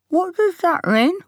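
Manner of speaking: put-on boy asking through a mouthful, slow; words round a bite of food; mouth full. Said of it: "speaks with his mouth full".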